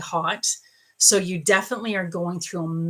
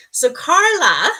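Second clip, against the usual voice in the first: loud, slow call of the name Carla; 8 semitones higher, 10 dB louder, slower than usual. Real, not acted. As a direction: call her name out across the room. Calling on viewer by name.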